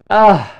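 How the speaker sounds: loud, exasperated "ugh"; big fed-up groan; disgusted groan. Real, not acted. Disgusted at the country remix.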